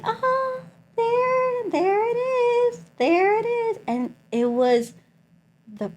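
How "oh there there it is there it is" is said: very high, drawn-out cooing; imitating delighted doctor. Doctor showing patient her baby on screen, high cooing. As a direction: coo it slowly, pitched way up.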